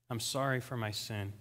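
soft, low confession to God; penitent prayer. Retold or imitated. Man confessing his sin to God, hushed and penitent. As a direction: apologise quietly and humbly, without tears. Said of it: "I began to weep like I've never wept before".

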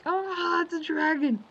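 high, loud alarmed yelp at a dragon; startled dragon cry. Retold or imitated. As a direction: yelp it like you just spotted a dragon. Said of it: "and he was like".

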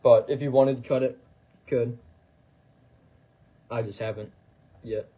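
choppy robotic voice; glitchy robot-like delivery. Real, not acted. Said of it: "talking like a robot".

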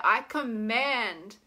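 slow, firm I command; drawn-out declaration. Shown as a demonstration. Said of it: "I want you to say it".

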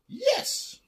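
very high, eager squeal; excited cry. A narrator's reading. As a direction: yelp yes like a delighted witch. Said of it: "cried the witch".

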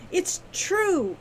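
high, firm insistence. A narrator's reading. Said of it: "she said firmly".

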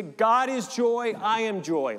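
high, loud, slow "God" stretched; joyful affirmation. Shown as a demonstration. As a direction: lift "God" high and slow, full of joy. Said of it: "you can say".

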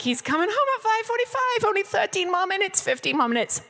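high, fast, clinging to the time; imitating her own tense countdown, pitched well up; anxious self-talk. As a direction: reassure yourself, a bit frantic. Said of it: "clinging on with my fingernails to".

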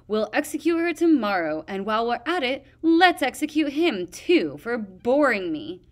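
loud, high, gleeful and cruel. A narrator's reading. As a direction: delight in the cruelty. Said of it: "said cheerfully".

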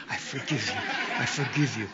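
laughing through the words; amused laughing retelling. Retold or imitated. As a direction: chuckle as you repeat it. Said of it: "I was proclaiming the F word".

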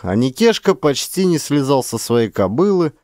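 ordinary delivery

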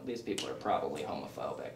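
very low, soft murmur; low, confidential thought to oneself; hushed aside. Retold or imitated. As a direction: murmur a private worry under your breath. Retold worried thought in crowd.

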